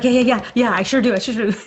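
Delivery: loud, high, gushing yeses; hammed-up overeager answer; mock eager reply. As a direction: tumble over yourself saying yes, playfully. Listener acts out his eager reply.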